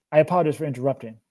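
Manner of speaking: fast apologetic aside; hurried apology, sped up; quickly. Acted. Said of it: "he added quickly".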